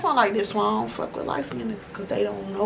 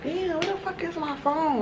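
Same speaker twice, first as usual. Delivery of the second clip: higher, softer panicked mutter; mock panic; 7 semitones higher, 6 dB quieter than usual. Realizing at her car the phone is gone, mock panic. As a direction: retell the moment the phone went missing.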